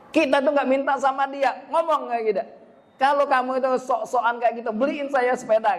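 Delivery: high, sassy mock mom voice; hammed-up mom daring the kid; mocking mother's retort. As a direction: toss it back with a sarcastic sting. Child answering back mockingly.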